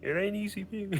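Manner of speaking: Kermit the Frog voice. Retold or imitated. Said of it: "Do your best Kermit the Frog impression".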